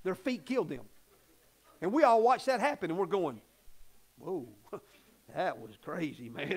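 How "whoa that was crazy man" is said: low, soft, laughing astonishment; a hushed, amused whoa; amazed, laughing. Retold or imitated. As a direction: mimic onlookers stunned and chuckling. Imitating stunned church onlookers.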